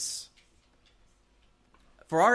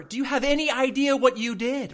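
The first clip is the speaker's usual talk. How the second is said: higher-pitched indignant challenge; mock angry scolding; 8 semitones higher, 4 dB louder than usual. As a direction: tell off the driver in playful indignation. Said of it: "I'm kinda angry".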